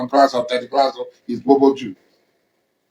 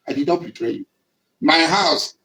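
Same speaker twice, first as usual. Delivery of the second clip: ordinary talk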